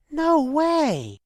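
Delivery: high loud astonished cry; shocked disbelief. Acted. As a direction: gasp it out in disbelief. Disbelief at discovery.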